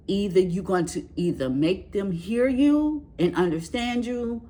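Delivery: usual speaking voice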